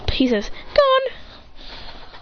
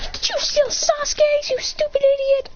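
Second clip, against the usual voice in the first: very high-pitched outrage; high acted indignation; shrieked accusation. Girls accuse him.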